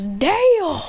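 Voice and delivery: high, drawn-out exclamation; impressed exclamation. Acted. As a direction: stretch it out, rising high then dropping.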